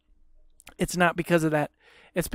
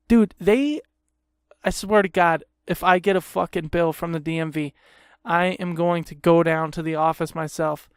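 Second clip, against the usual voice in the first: normal talking voice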